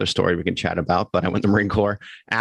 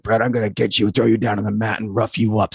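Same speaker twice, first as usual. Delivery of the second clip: put-on a light Jersey accent, tough-guy swagger; 2 semitones higher than usual. Navy captain ribbing a young Marine, light Jersey accent. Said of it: "had the Jersey accent".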